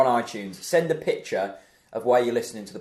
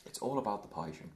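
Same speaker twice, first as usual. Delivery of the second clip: lightly put-on awful Irish accent; a hammed-up Irish pundit voice, barely shifted; Paul McVeigh Irish accent; 11 dB quieter than usual. Joking about a pundit on the podcast, in a mild, bad Irish accent. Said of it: "That is an awful Irish accent".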